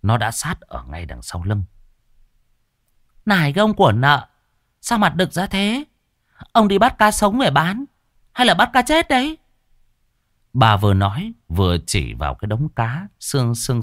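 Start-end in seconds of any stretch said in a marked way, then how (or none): 3.3-9.4 high, loud sarcastic nagging; mock him, loud and high; sharp-tongued wife. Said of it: "cái giọng chanh chua".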